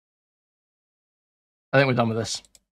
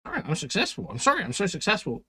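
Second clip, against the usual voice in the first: a touch higher, whiny mock of the streamer; mocking imitation; 5 semitones higher, 10 dB quieter than usual. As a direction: mimic him as a sulky, self-pitying streamer. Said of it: "He's like".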